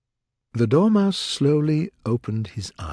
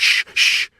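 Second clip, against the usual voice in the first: loud unvoiced shushing; hushing; 5 dB louder than usual. A narrator's reading. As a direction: hush someone sharply. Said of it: "the Hatter and the March Hare said".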